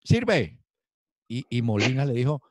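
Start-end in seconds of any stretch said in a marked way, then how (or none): 0.0-0.6 clipped high "Sirve" from a doctor rubber-stamping recruits; army doctor quoted in a higher voice, signing off a recruit; bored army doctor. Retold or imitated. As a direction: toss it off like a doctor who passes everyone. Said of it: "el tipo le ponía uno el estetoscopio y decía".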